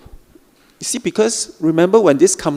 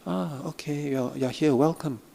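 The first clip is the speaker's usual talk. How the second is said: much softer, gentle welcoming murmur; soft welcome; 9 dB quieter than usual. Shown as a demonstration. Teacher showing how to greet the critic softly, much softer welcome. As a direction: soften everything and greet the feeling kindly. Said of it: "So you just soften everything".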